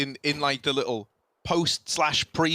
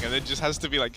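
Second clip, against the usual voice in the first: normal talking voice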